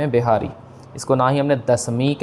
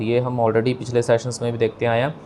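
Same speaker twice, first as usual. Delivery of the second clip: ordinary talk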